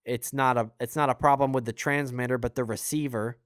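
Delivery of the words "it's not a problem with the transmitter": ordinary talk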